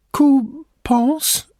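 put-on very high, quavering old woman stammer; trembling voice. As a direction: stammer it with a shaking voice. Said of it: "stammelte sie mit zitternder Stimme".

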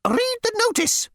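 high, fussy insect voice; insect receptionist. Acted. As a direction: squeak it like a prissy bug. Insect snaps at angry man.

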